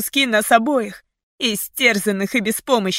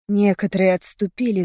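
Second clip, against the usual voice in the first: ordinary delivery, nothing marked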